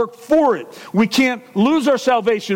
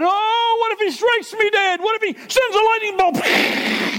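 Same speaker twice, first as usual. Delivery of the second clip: high, mock-scared fretting; mock fearful; 10 semitones higher than usual. Preacher mocking a fearful believer, high mock fear. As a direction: ham up a fearful believer cowering. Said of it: "it's not like we're called to fear God".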